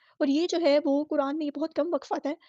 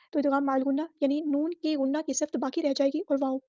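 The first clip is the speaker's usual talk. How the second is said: ordinary talk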